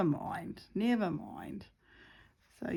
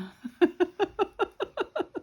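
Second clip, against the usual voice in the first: high laugh; 12 semitones higher than usual. Real, not acted. Laugh after child voice.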